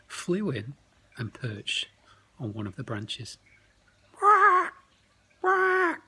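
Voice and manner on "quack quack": very high, loud bird squawks; seagull squawk. Acted. Giant seagull lands on the branch, loud squawking. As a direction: squawk like a big excited seagull.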